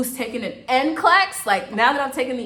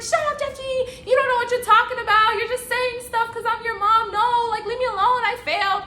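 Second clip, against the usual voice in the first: much higher imitation of mom; mom brushing off son; 14 semitones higher, 4 dB louder than usual. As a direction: play mom's high voice telling her son to drop it. Mom waving off son's praise.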